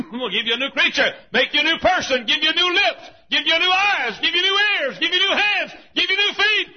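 loud repeated promises climbing high; full-force preaching. Real, not acted. Preacher promising sinners a new life, climbing high shout. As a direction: build each phrase into a shout.

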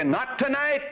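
high singsong imitation of refusal; mock putting-off. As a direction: mimic someone waving it away. Voices people putting off salvation.

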